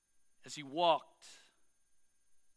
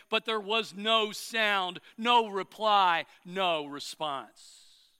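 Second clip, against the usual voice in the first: slow, spaced triple beat, fading out; dramatic slowing; 4 dB louder, much slower than usual. A narrator's reading. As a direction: let each no land, slower and slower. Dramatic build of silence.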